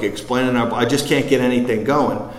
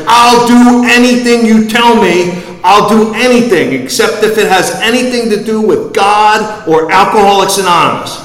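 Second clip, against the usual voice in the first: desperate shouting; 10 semitones higher, 10 dB louder than usual. Retold or imitated. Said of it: "He's like".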